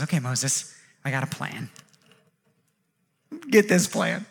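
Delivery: low, quiet, lightly voiced God; God confiding. God tells Moses he has a plan, confiding.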